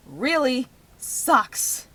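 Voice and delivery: normal talking voice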